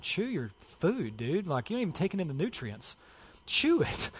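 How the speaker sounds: lightly acted dad telling kids off; mock exasperated dad. Acted dad scolding fast-eating kids.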